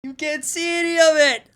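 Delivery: high-pitched triumphant cry; gleeful shout. Real, not acted. Artist telling chat the gun is hidden in shadow, gleeful shout. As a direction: crow it high, straight out of laughter.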